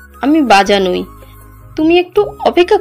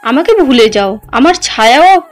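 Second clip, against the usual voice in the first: suppressed pain; 3 semitones higher than usual. Acted. Heartbroken wife bids husband farewell, pain held in. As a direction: hold the hurt down as you let him go. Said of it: "সব কষ্ট চেপে নিয়ে উত্তর দেয়".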